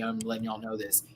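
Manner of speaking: ordinary talk, nothing marked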